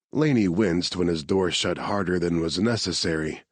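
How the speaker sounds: ordinary delivery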